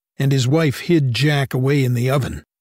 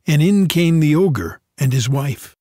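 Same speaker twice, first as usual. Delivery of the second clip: usual speaking voice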